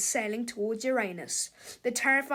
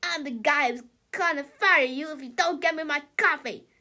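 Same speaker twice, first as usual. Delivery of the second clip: bossy yell; 3 semitones higher, 5 dB louder than usual. Acted. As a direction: demand your coffee. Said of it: "yelled Melvin".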